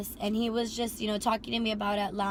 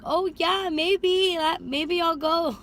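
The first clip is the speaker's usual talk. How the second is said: coy tease; 7 semitones higher, 4 dB louder, much slower than usual. Retold or imitated. Coyly teasing driver reply.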